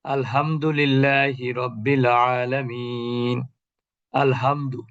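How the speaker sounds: আলহামদুলিল্লাহি রব্বিল আলামিন recited slowly, ha from the middle of the throat; slow Quranic recitation. Shown as a demonstration. Teacher reciting slowly to show the throat sound. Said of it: "হায়ের জায়গায় হা বলে ফেলি".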